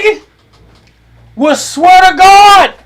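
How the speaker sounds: usual speaking voice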